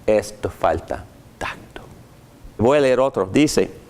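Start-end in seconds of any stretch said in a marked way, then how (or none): 0.0-1.8 softer unvoiced aside; a hushed aside to the congregation; whisper. Real, not acted. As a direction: whisper it like a confidential verdict. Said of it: "aside whisper".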